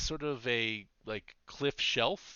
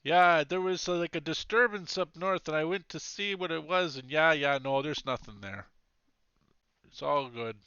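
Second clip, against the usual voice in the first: put-on loud Scandinavian-accented sentry; 4 semitones higher, 7 dB louder than usual. Sentry reporting back on a disturbance, calling in Scandinavian accent. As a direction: call out a report in a Scandinavian lilt. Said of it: "now he's Scani".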